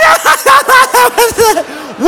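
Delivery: high, loud staccato mock cackle; hammed-up hysterical laughing fit; imitating someone laughing too hard to breathe; imitated breathless laughing. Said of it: "she can't breathe".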